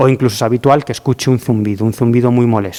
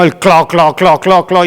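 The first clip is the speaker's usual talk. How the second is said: clo clo clo, clicked out higher and louder like heels on a floor; imitated heel clicks; 5 semitones higher, 5 dB louder, slower than usual. Imitating the nurse's loud heels in the operating room, clicking sound. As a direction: tap out the nurse's heels with your voice. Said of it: "hacía un ruido increíble".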